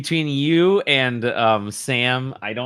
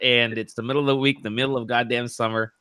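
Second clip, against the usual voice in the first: ordinary talk, nothing marked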